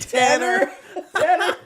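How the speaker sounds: high, loud, drawn-out laughing Tanner; mock Tanner. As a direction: sing out the name high and laughing. Mocking the name Tanner.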